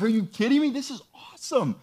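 high-pitched, thrilled disbelief; overjoyed excitement. Acted. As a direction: burst out as if overjoyed at amazing news. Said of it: "He's excited, overjoyed".

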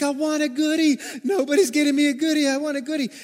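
high, loud imitation of a whining child; crying toddler. Three-year-old complaining to an adult, imitated loud, high crying whine. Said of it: "She's crying".

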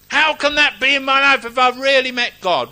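high, loud mock wail of disbelief; anguished protest. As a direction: cry it out like a distraught believer. Acts out a troubled believer's protest.

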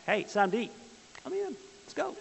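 high called-out camp call; calling out to campers. Retold or imitated. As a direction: shout them in for lunch, voice up high. Camp staff calling campers to lunch.

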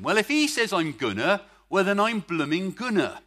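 hearty Bristol accent, "blooming gonna"; gleeful West Country Bristol accent with a laugh; Bristol version. Shown as a demonstration. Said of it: "I prefer the Bristol version".

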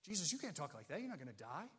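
lightly acted, hushed objection; confronting. Disciple objecting to his teacher's words, mildly confronting. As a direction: protest softly, as if correcting your teacher. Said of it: "He will confront Jesus".

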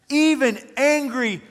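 milk the despair like a sulking prophet; dramatic Jonah. Retold or imitated. Preacher voices Jonah sulking to God, hammed-up dramatic. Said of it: "he was being super dramatic".

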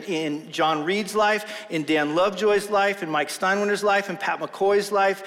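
usual speaking voice

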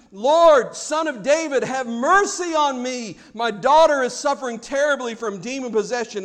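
acted loud plea, big hit on the first word; imitating a desperate yell, easing toward the end; crying out. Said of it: "She is yelling at the top of her lungs".

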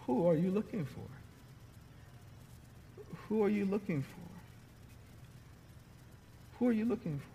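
quiet, tender asking, each one gentler; soft repeated question. Acted. Jesus to Mary at the tomb, soft and patient. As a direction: let each repeat land softly.

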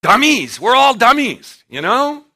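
high, loud call of dummies; mock scolding cry; loud playful jab. Real, not acted. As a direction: shout it out with a grin. Preacher calling everyone dummies.

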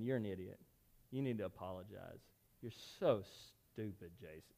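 low, hushed reproach; imitated inner conviction. Holy Spirit chiding him over the grudge, low quiet reproach. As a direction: murmur it like a nagging conscience. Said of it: "the Holy Spirit just working on my heart".

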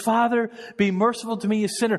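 high, loud cry for mercy; pleading sinner. Acted. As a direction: cry out to God as the broken tax collector. Tax collector's plea voiced.

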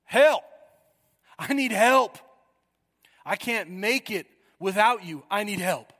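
loud, high cry for help that settles by the end; a desperate plea, shouted then pleading. Retold or imitated. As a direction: call out as if the current has you. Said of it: "I want you to think".

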